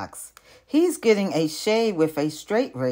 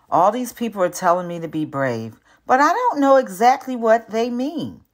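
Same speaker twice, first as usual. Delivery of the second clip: ordinary delivery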